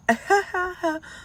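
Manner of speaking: high mock giggle; high-pitched put-on cackle; imitated children's laughter. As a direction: mimic kids laughing at their mother. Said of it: "And this is them".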